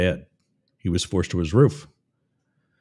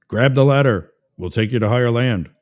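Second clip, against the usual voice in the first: loud, raised rescuer's shout; calling down; 6 dB louder than usual. Acted. Coast Guard in a helicopter to a man on a roof, calling down. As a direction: shout instructions down to someone below.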